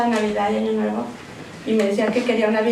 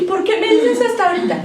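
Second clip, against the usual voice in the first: high, pained cry of a question; retold dismay; 10 semitones higher than usual. Sister asking her sister why so late, pitched high and hurt. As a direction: ask it much higher, hurt and surprised. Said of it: "le dije".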